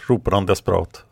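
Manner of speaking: usual speaking voice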